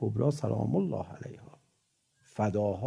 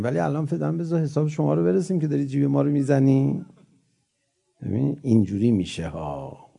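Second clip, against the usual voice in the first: ordinary talk, nothing marked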